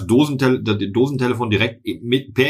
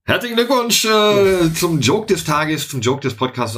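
mock congratulations; 7 semitones higher, slower than usual. Mock congratulations on a bad joke.

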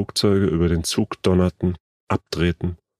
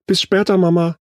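put-on higher, louder girl's goodbye; calling out; 12 semitones higher, 5 dB louder than usual. A narrator's reading. Lene leaving for school, calls goodbye to her mother, calling out. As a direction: call it out quickly to mother. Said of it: "rief Lene".

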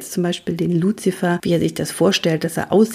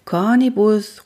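higher, drawn-out call; 5 semitones higher, 4 dB louder, slower than usual. A narrator's reading. Luzifer looks for hiding Cornibus, calling out. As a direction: call the name slowly, raised in pitch. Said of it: "rufe ich".

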